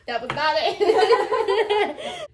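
loud, high words through laughter; real hard laughing. Real, not acted. Reacting to partner's wrong guess, laughing hard. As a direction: crack up, stretching the words out loudly.

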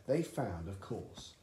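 ordinary delivery, nothing marked

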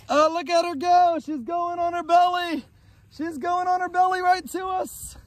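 high, thrilled play-by-play of a slide; excited commentary. Real, not acted. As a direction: follow the slide with voice pitched right up. Watching child slide down on belly.

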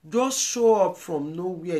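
indignant repeat. Real, not acted. Repeat of the complaint.

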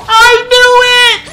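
high shrieking laughter between cries; laughing scream. Real, not acted. Overjoyed at the reveal, she shrieks with laughter.

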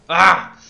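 short loud cry of frustration; frustrated yell. Real, not acted. Gamer misses the kill, loud frustrated ah. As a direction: let out a sharp angry ah.

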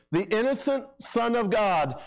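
ordinary talk

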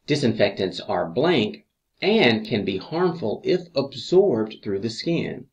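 usual speaking voice

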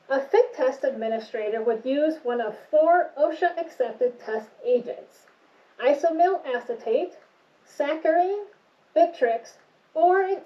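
muffled behind respirator; through a face mask. Real, not acted. Seen 0: Respirator covers mouth and nose throughout; reference frames show it held in hand.

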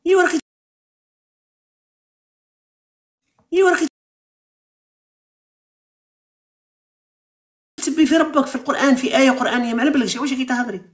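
you're insulting your own God, push back; heated rebuke. Real, not acted. Host scolds caller for insulting God, heated and high.

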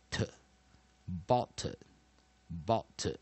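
"t" as a weak tuh, then "bot" slowly with it; syllable demo. Shown as a demonstration. Teacher sounding out the weak second syllable, "bot" said slowly. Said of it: "所以我们念念t".

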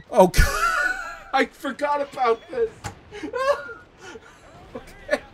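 high, helpless laughter through the words; cracking up mid-read; laughing. Real, not acted. Reading fanfic aloud, bursts out laughing at the line.

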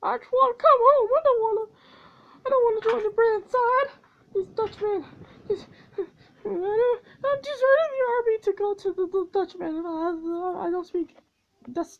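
high, loud hammed-up sobbing whine; mock sobbing deserter. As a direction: blubber like a soldier fleeing.

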